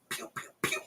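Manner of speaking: ordinary talk, nothing marked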